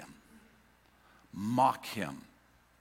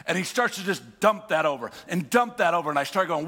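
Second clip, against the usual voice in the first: normal talking voice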